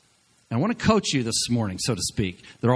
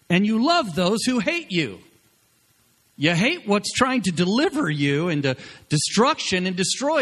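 Joab's rebuke; 6 semitones higher than usual. Retold or imitated. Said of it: "And Joab says".

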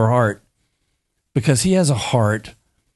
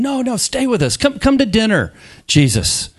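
high pleading, hammed-up; mock begging voices; begging disciples; 11 semitones higher than usual. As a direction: plead with him to stay, pitched way up. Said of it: "they're begging him".

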